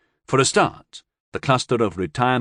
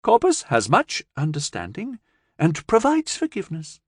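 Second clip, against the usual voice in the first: lightly voiced alien; 7 semitones higher than usual. Alien speaks.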